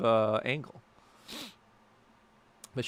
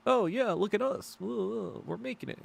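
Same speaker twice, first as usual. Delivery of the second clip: higher, smug mock cheer, drawn out at the end; sarcastic mock boast; 9 semitones higher than usual. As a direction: sneer it like a self-satisfied creator.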